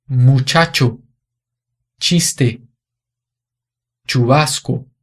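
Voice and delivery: muchacho, chiste, chubasco with a crisp "tch" like Portuguese tch; teacher modelling the ch in muchacho, chiste, chubasco; Spanish ch sound. Shown as a demonstration. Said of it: "Esse som ch".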